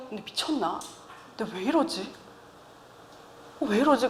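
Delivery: quiet wondering whether she's lost it; puzzled muttering to self. Retold or imitated. Mother quietly questions herself after yelling, bewildered. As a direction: ask yourself softly what's wrong. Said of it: "제가 이러고 있다가".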